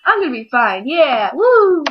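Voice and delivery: high, loud, cheering; patient cheering after the nice nurse, loud; pumped up. Retold or imitated. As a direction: hype yourself up and whoop. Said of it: "you're all like".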